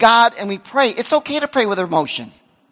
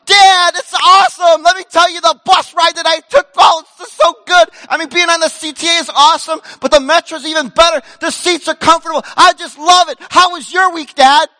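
higher, louder, gushing enthusiasm; gushing son; 6 semitones higher, 6 dB louder than usual. Retold or imitated. Son Dan bursting in from school, loud and high gushing. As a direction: overflow with excitement about the bus. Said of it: "he goes".

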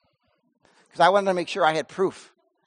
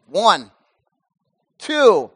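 normal talking voice

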